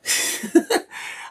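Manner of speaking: a breathy, high chuckle; airy laugh. Real, not acted. Streamer chuckling to viewers between thoughts. Laughs at his own line.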